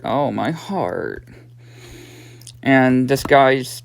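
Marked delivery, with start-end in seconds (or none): 0.0-1.3 slow, low, soft mock groan of pain; lightly voiced hurt man, words drawn out; Homer. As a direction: drag it out low and quiet, lightly acted. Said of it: "Homer's like".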